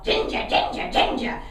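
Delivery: high squawking parrot calling a name. Acted. Parrot squawking at Ginger in the baker's, high and loud. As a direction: screech it like a talking parrot. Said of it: "the parrot would start".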